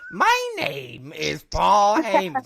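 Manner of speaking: slow mock Paul Heyman intro. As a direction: announce yourself like a wrestling manager. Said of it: "See, I can do that".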